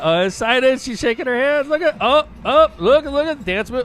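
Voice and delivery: high, loud, real excited shrieks at the clip. Real, not acted. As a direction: yelp along as the video gets wilder. Reacting to a wild jumbotron clip.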